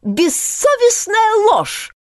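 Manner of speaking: high, slow indignant cry; a bird crying out in outrage; shout. A narrator's reading. Sparrow shouts at the bully, high indignant cry. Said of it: "закричал Аполлон Мухолов".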